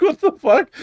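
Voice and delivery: high disbelieving outburst; shocked. Retold or imitated. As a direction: blurt it in total disbelief. Shocked reaction retold.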